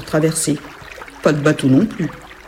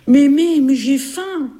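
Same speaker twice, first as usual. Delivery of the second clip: put-on high child's whine; whining little girl; 9 semitones higher, slower than usual. Little girl complaining to grandmother, high whiny voice.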